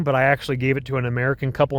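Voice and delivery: ordinary talk, nothing marked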